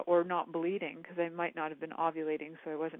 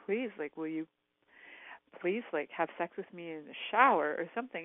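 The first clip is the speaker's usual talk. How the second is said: ordinary talk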